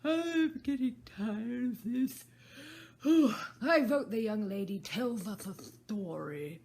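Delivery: slow, loud, yawning hare; bored hare wanting a story; tired March Hare. Acted. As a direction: yawn through it as the March Hare.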